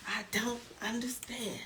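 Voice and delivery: very soft whispered echo; fading to whisper. Pretend. Host baffled by the players, whispered repeat. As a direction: let it die away into a whisper.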